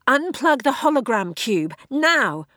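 high, loud urgent order; urgent command. Acted. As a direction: bark the order fast.

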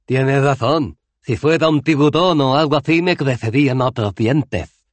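sarcasmo fuerte y agudo; sarcástico. A narrator's reading. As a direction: Cohen se burla de la idea. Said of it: "replicó Cohen con sarcasmo". (réplica sarcástica, más fuerte)

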